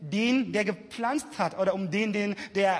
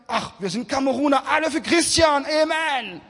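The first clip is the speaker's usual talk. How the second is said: mock fan cheer; 6 semitones higher, 5 dB louder than usual. Preacher mocking a church faction, cheering loudly. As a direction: hype it up like a fan club cheering.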